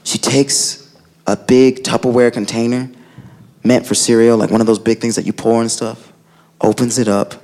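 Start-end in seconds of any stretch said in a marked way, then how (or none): none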